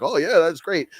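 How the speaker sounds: high-pitched, gullible delight; mock eager agreement. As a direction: play them as delightedly taken in. Eagerly accepting the cake offer.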